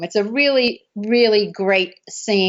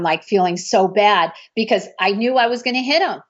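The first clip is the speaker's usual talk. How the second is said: usual speaking voice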